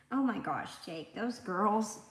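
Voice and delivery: ordinary delivery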